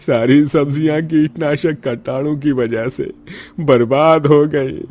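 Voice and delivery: tearful, drawn-out farmer's lament; despairing lament. Acted. Farmer bewails his ruined crop and debt, wailing.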